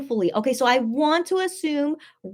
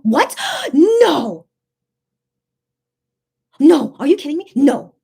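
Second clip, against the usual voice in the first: loud, stunned outburst; shocked disbelief; 3 semitones higher, 9 dB louder, slower than usual. Real, not acted. Fan hearing of Best Friends Forever Day, shocked disbelief. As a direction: cry out in disbelief at the news. Shocked by tomorrow's show news.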